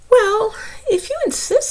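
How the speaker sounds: high whispered chuckle; uneasy bear agreeing; reluctant bear. Acted. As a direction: give in with a nervous laugh.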